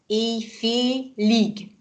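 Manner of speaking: "Éjfélig" drawn out syllable by syllable; teacher's dictation-slow "Éjfélig". Shown as a demonstration. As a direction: sound out "Éjfélig" very slowly. Teacher sounds out word after spelling.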